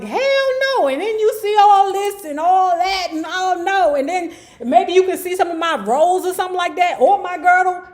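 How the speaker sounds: high, loud, hammed-up refusal; over-the-top mock indignation; imitated diva outrage. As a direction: act out an appalled star protesting the camera. Acts out star refusing camera angle.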